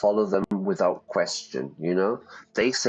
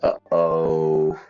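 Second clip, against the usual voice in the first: ominous uh-oh; 3 semitones lower, 3 dB louder, much slower than usual. Real, not acted. Reacting to witch's yellow eyes.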